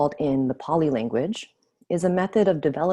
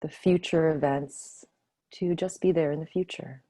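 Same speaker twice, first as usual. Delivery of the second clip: guided meditation. Acted. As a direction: ease them gently back to the room. Leads closing of meditation.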